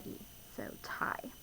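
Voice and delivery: soft whispered word. Real, not acted. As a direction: breathe it out softly to the class. Teacher whispers word to class.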